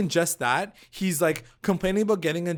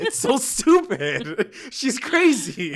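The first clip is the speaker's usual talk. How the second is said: higher, slow amused drawl; laughing at a friend's meltdown; 8 semitones higher, 4 dB louder, slower than usual. Real, not acted. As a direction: say it while laughing.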